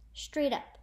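ordinary delivery, nothing marked

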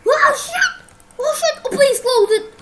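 loud, high shrieks through laughter; half-laughing fright at a jump scare; laughing panic. Real, not acted. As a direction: scream and laugh at once.

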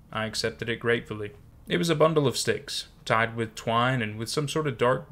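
ordinary delivery

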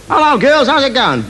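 cheery bloke. Retold or imitated. Aussie bloke greets women.

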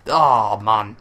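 usual speaking voice

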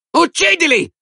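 very high-pitched shriek of a woman; piercing, high hostile cry. A narrator's reading. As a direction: shriek for blood from the crowd. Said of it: "strillò la donna tarchiata".

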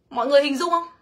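ordinary talk

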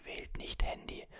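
ordinary talk, nothing marked

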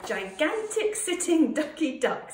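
loud, high-pitched announcement; proud showing-off. A narrator's reading. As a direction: show it off at full voice. Roy shows off his sculpture.

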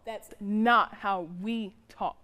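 slow, weighty stress on "not"; firm correction. Acted. Mother correcting her son's foul mouth, slow and firm. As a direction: spell it out slowly, leaning on "not".

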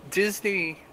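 ordinary talk, nothing marked

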